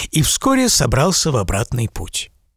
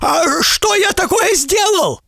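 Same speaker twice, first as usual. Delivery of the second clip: high, slow pleading question; frightened merchant. A narrator's reading. Merchant begging the giant to explain, frightened high cry. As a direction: plead innocence in a panic.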